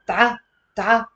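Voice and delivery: Ta-, ta said quickly, the ta with sukun clipped short; fast syllable drill. Shown as a demonstration. Teacher drilling the class to say it fast. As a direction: snap out Ta-, ta fast. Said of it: "Say with me fast".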